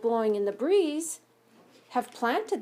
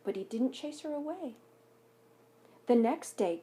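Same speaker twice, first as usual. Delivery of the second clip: put-on gentle read-aloud voice for children; story narration; 4 semitones higher than usual. A narrator's reading. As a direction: read it like a picture book at story time. Reads picture book aloud.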